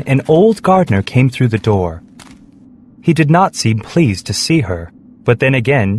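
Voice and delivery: plain reading voice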